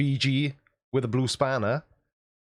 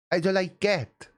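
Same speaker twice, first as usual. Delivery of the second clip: higher, whiny "I don't like it"; childish whine; 5 semitones higher than usual. Shown as a demonstration. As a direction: whine it like a fussy kid.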